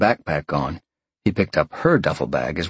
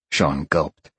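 usual speaking voice

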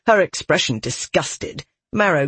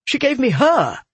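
high, outraged stress on the end; accusing; 9 semitones higher than usual. A narrator's reading. Soldier pointing at the girl, accusing. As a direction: accuse in rising disbelief. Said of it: "stabbing a finger at Marrow".

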